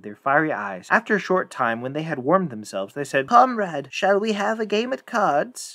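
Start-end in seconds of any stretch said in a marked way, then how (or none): 3.3-5.7 high, slow, drawn-out cat voices; sinister cats. Acted. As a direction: coax like an eerie talking cat.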